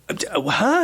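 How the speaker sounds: high, stammered mock confusion; baffled stammer. Doctor hearing the cop fired five times, high baffled sputter. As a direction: sputter like you can't believe it.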